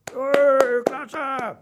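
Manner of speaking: loud, high drawn-out cheer; cheering audience. A narrator's reading. Animal audience joins the applause for Werner, cheering. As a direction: whoop with high-pitched delight. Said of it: "stimmten die Wettbewerbsbesucher in Mausels".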